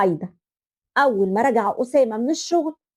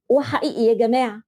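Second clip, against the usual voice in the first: ordinary talk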